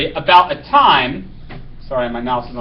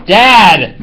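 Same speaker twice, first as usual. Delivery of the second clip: a loud call of Dad; yelling; 9 dB louder than usual. Acted. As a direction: call out after him, fed up. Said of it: "I yell after Anna's gone".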